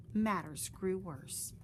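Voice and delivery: ordinary delivery, nothing marked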